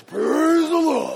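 drawn-out cry of big rocks; preacher voicing boulders crying out; boulders' praise.

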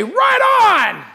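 high, loud "right on"; pretend approval. Preacher imagines God approving, a loud high shout. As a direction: cheer it like an excited fan. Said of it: "don't you expect God to say".

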